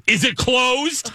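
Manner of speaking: high, slow disbelieving question; outraged disbelief. Retold or imitated. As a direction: ask it in shocked outrage. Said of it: "And I said, I go".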